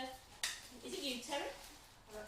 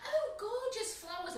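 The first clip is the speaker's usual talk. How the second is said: put-on delighted old lady; 9 semitones higher, slower than usual. Retold old lady's delight.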